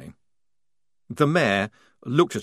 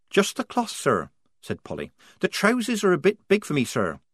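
put-on higher young soldier voice; Polly voice; 8 semitones higher than usual. A narrator's reading. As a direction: voice the young recruit.

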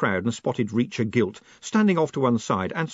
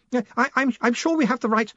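high, hesitant stammer; flustered assistant; 13 semitones higher, faster than usual. A narrator's reading. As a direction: stumble over a nervous reassurance. Flustered assistant answers bellowing boss.

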